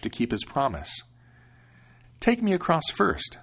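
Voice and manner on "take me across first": put-on higher, lighter young man; eager young man answering; young hero. Hero to ferryman.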